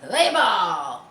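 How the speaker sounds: loud call to play; a loud umpire call; shout. Acted. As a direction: yell it to the pitcher. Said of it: "Mary shouted".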